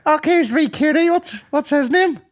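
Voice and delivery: put-on very high, drawn-out baby talk; cooing over baby. Someone greeting the newborn asks its name, high cooing voice. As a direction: coo in a squeaky high voice.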